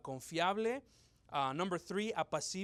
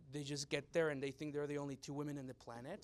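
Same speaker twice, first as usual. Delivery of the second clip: usual speaking voice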